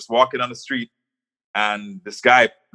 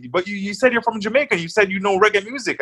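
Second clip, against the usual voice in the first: higher, mock-reproachful; teasing disbelief, raised pitch; imitating the driver; 8 semitones higher than usual. Driver scolding, retold.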